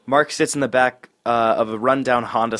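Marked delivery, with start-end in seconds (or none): none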